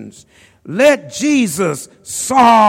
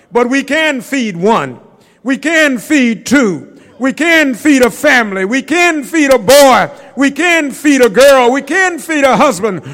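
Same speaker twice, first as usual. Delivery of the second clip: louder and higher with each "we can feed", punching "can"; building preaching; 4 semitones higher, 4 dB louder than usual. Acted. Preacher rallying the church that they can feed one, rising to a shout. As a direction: climb the ladder line by line, preacher's rising chant.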